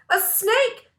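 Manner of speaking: high, loud alarmed shout; frightened cry. A narrator's reading. Girls freaking out over the snake, a high alarmed cry. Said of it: "screamed all the girls".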